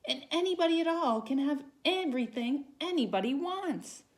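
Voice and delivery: high, eager and loud; bursting with big plans; excited. Acted. Sylvester dreams of wishes.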